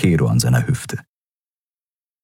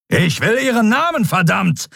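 much higher, thundering "verdammt"; thundering demand. A narrator's reading. Furious admiral demands the prisoner's name, thundering. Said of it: "donnerte Telios".

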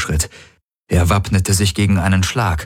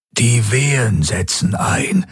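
put-on old doctor; 6 semitones higher, slower than usual. A narrator's reading. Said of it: "sagte der alte Aur".

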